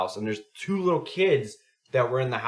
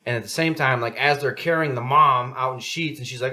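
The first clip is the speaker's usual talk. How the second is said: normal talking voice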